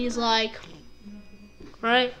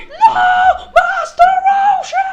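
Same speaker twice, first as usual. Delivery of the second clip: very high, loud, drawn-out cry; anguished mock scream; 10 dB louder, slower than usual. Goku sees his master beaten, hammed-up high scream. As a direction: wail it in hammed-up horror.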